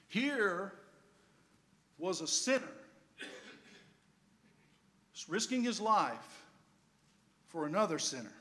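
slow, loud preacher's build; preaching. Acted. Preacher to the congregation, rising to a slow, loud close. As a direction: proclaim it from the pulpit.

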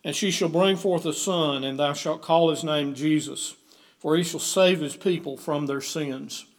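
usual speaking voice

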